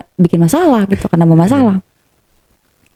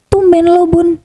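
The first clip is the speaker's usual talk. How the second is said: high-pitched imitation of a surprised partner; retold surprise; 10 semitones higher, slower than usual. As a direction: act out his puzzled reaction, pitched up. Partner surprised by drink suggestion.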